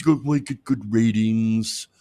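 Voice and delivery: slow, drawn-out mocking impersonation; put-on politician voice, slowly; Donald Trump. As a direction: stretch it out like a gloating politician. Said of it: "And he says".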